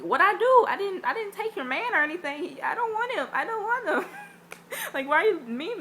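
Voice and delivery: high, loud protest; mock indignant. Protesting to the glaring little girl, indignant. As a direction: plead innocence, high and loud.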